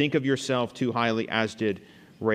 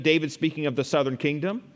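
normal talking voice

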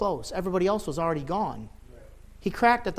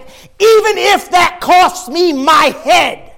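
building to a full-force, high preacher's shout; slow, hammered declaration of total devotion; impassioned preaching; 9 semitones higher, 15 dB louder, slower than usual. Acted. Preacher voicing a sold-out believer's vow, rising to a shout.

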